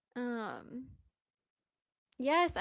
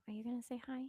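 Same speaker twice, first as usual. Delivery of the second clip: whispering; 6 dB quieter, much faster than usual. Real, not acted. Whispering to the dog.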